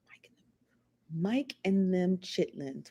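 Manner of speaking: hushed and slow, mulling it over; whispered aside. Real, not acted. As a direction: whisper it to yourself, puzzled and slow. Muses over a puzzling comment.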